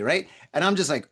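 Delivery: usual speaking voice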